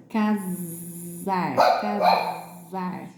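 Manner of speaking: casar said slowly with a long buzzing Z; stretched Z sound. Shown as a demonstration. Teacher answering the pupil's question, drawn-out casar with Z. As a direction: hold the Z in casar before "ar". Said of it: "é o S com som de Z".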